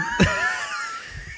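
very high laughing shriek; real burst of laughter. Real, not acted. Bursts out laughing mid-story.

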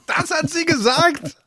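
high-pitched burst of real laughter. Real, not acted. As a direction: laugh high through the words. Laughing at wife's insult.

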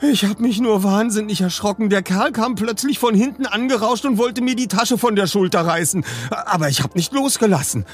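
high, agitated recounting; breathless. A narrator's reading. Robbed woman tells of the attack, gasping. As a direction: tumble it out, winded from fright. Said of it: "keuchte sie".

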